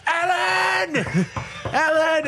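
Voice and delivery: high, far-off shouting of her name; drunken shout. Retold or imitated. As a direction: bellow it through the door like a drunk rock star. [name] yelling at her door.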